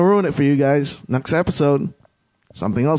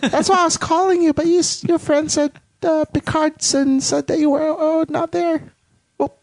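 high woman voice; 10 semitones higher than usual. Pretend. Voicing Janice to Picard.

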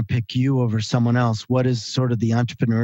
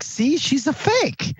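skeptical; slower than usual. Retold or imitated. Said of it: "they look at me like".

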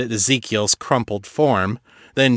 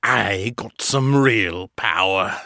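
gloating villain; slower than usual. Acted.

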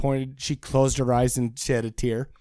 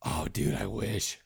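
hushed whisper; 9 semitones lower, 12 dB quieter, slower than usual. Real, not acted. Host whispering to his co-host in sudden dismay, a hushed whisper. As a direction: drop to a breathy whisper.

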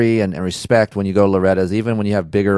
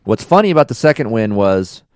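usual speaking voice

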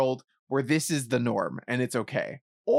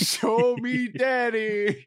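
slow mock echo; 6 semitones higher, much slower than usual. Echoes cohost's line, drawn out.

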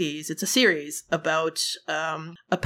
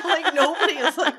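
ordinary talk, nothing marked

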